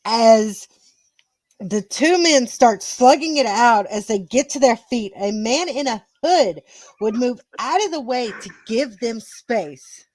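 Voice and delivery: emphatic match storytelling; wrestling commentary. Acted. Narrator telling the title match to co-hosts, commentary style. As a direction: punch the key words of the action.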